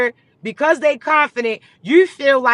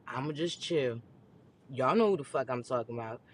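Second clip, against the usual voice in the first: much lower, softer aside to herself; muttered aside; 11 semitones lower, 14 dB quieter than usual. Real, not acted. Holding back from naming names, low mutter. Backs off, drops voice.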